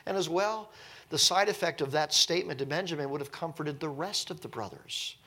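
ordinary talk, nothing marked

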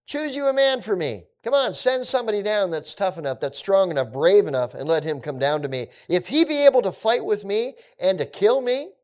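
high, loud taunting challenge; Goliath mocking the armies of Israel; mocking giant. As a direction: dare them to send a man. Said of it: "mocking them at this point".

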